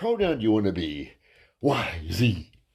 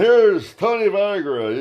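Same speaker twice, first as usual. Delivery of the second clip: announcer intro; 6 semitones higher, 4 dB louder, slower than usual. Retold or imitated. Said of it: "the husband".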